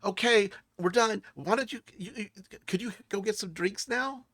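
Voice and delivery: put-on high-pitched pleading child voice; child Zale. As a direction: ask like a little kid wanting a toy. Game master voices child Zale.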